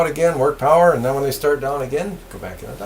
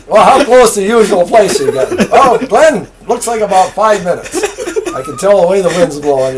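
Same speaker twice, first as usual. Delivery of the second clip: retold radio chat, higher, louder, laughing; laughing through quotes; 7 semitones higher, 8 dB louder than usual. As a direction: tell the back-and-forth while cracking up. Retelling the joking radio exchange.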